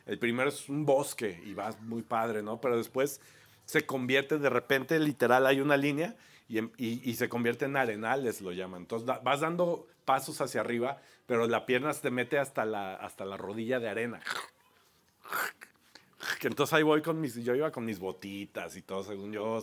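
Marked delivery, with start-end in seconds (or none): none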